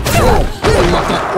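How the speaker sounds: very high, loud panicked yelps; real high-pitched alarm; alarmed shout. Real, not acted. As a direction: cry out under sudden attack. Wolf attacks the player.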